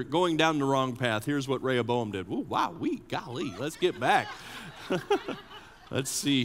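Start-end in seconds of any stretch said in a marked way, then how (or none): none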